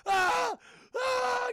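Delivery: very high, loud screams; imitated shrieks from a panicking film victim; mock screaming. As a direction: scream in hammed-up terror. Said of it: "all they hear is screaming".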